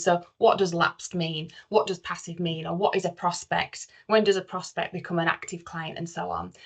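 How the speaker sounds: usual speaking voice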